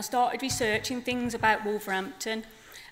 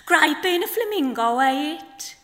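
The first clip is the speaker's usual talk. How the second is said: higher, louder chirpy chick voice; baby flamingo; 5 semitones higher, 6 dB louder than usual. Acted. Baby flamingo pestering his nan, chirpy and high. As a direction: pipe up like an eager little chick. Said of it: "in the voice of two flamingos".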